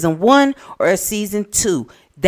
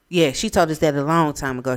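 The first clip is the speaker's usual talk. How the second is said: normal talking voice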